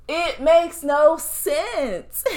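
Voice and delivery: high, slow, laughing exasperation; disbelieving laugh, stretched out slow; laughing in disbelief. Real, not acted. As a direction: laugh it out, can't believe it.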